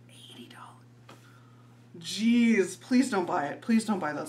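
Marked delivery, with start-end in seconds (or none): 0.1-1.2 whispered in mock horror. Real, not acted. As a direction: breathe it out in disbelief. Repeated price whispered after.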